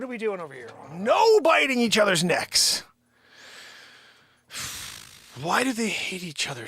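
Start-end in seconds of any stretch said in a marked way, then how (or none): 0.9-2.8 slow, loud telling-off; loud, high-pitched reprimand; loud scolding. Real, not acted. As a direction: shout a high, drawn-out order at the dogs. Scolds the fighting dogs.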